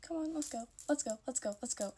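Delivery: high, pressing let's go; urging friend to leave. Retold or imitated. To friend at car meet, urgent pleading. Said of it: "And I was like".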